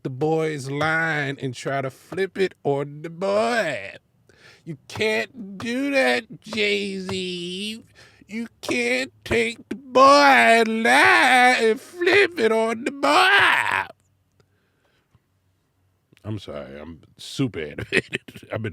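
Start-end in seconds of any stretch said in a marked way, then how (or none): 4.7-7.8 slow scolding. Real, not acted. 8.3-13.9 high, loud, slow wailing cry; wailing protest. Real, not acted. Protesting [name] flipping [name]'s line, wailing cry.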